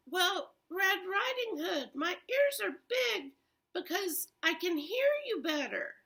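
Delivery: high faked grandmother voice; wolf pitching up to sound like grandma; wolf in granny voice. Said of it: "the wolf said".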